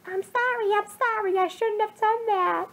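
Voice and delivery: high, crying voice repeating an apology; a boy apologizing while crying into his knees; tearful mumble. Acted. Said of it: "chose only to mumble".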